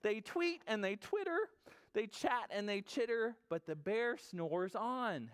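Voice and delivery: high, chirpy read-aloud; storybook narration. A narrator's reading. Storyteller on chattering animals round sleeping bear, high and playful. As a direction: chirp the animal chatter, then stretch the refrain.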